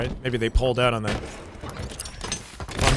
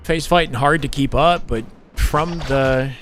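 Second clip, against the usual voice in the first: esports caster; 4 semitones higher than usual. Acted. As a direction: call the fight with rising excitement.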